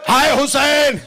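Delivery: impassioned mourning cry; full-force lament. Acted. Climax of the lament.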